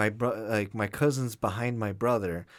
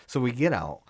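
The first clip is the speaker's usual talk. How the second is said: normal talking voice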